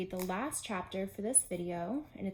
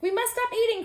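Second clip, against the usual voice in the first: much higher, loud cry; cried out; 14 semitones higher, 8 dB louder than usual. Acted. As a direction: cry it out while still eating. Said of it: "cried Toad".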